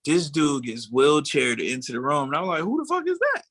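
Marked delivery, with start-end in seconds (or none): 2.6-3.4 high squeaky disbelief; who is this guy, way up high; shocked outburst. Retold or imitated. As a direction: pitch jumps way up in shock. Shocked in court, retold.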